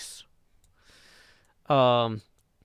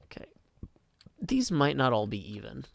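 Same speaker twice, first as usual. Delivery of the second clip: ordinary delivery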